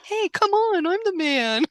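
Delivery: high-pitched comic coaxing; hammed-up high little protest; mock pleading husband. Retold husband playfully coaxing his wife.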